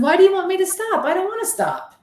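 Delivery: high, fast, indignant pleading; defiant protest. Retold or imitated. Medium refusing the girl spirit's warning, high indignant protest. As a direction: push back hard, refusing to stop.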